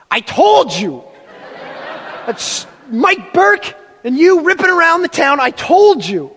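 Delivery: a hint of a scolding mother; told-you mom. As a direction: scold like a mother proven right. Mom scolding from outside bathroom.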